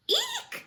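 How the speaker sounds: very high startled eek; a mother bird's fright; shrill shriek. Acted. Mama Bird sees the babies hanging, high shriek. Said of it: "she cried".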